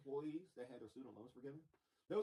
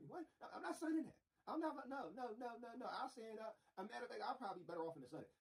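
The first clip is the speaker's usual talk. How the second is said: mock outburst; 8 semitones higher than usual. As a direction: squeal out the refusal. Mock reply of himself in Congress.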